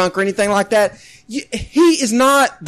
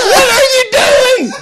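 laughing disbelief; 13 semitones higher, 5 dB louder than usual. Retold or imitated. Exasperated laughter.

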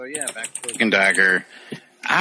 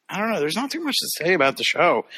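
ordinary talk

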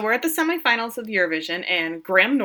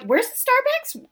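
much higher, slow clueless tourist; dumb tourist voice; 3 dB louder, slower than usual. Retold or imitated. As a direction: play a dopey, eager American. Dim American tourists asking.

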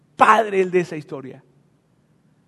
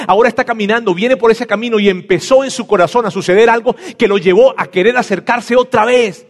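high, loud, driving sermon voice; preaching at full force; 6 semitones higher, 6 dB louder than usual. Acted. Preacher stirring the congregation, full-force preaching. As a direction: push the story toward the climax.

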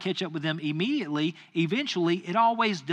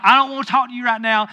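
fast, loud, higher-pitched rebuff; heated, hurried brush-off; retold angry dismissal; 6 semitones higher, 7 dB louder, faster than usual. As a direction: re-enact snapping at a teenager.

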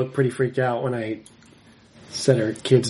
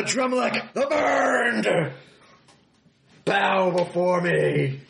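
booming demon lord; 5 semitones higher, slower than usual. Pretend.

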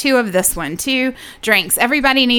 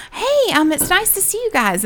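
imitating the neighbor, much higher; high-pitched neighbor voice; 10 semitones higher than usual. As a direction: lightly mimic a chirpy neighbor. Neighbor voiced in retold story.

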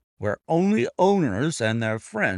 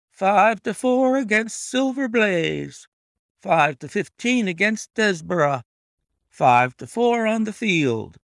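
high, loud odds-calling, held long; roaring bookmakers; 9 semitones higher, 5 dB louder than usual. A narrator's reading. Bookmakers crying odds around the ring, roaring. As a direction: sing out the bets over the crowd. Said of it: "roared the ring".

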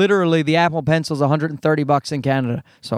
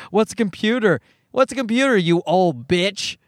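mocking bratty girl; 4 semitones higher than usual. Comedian mocking the ad girl, in a high, sneering little-girl voice. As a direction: sneer it like a snotty kid. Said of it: "Just be polite".

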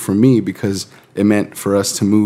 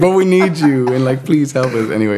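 higher, mock-pleading church voice; 3 semitones higher than usual. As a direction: beg sweetly, hammed-up.

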